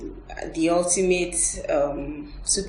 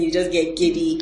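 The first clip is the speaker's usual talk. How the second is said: normal talking voice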